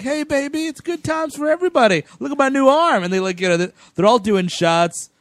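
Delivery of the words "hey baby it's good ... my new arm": put-on much higher, breezy "hey baby" voice; lightly voiced happy drunk showing off; party smooth talker. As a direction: play the jolly guy bragging at the bar. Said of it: "Brad Dourif's being the voice of reason, like".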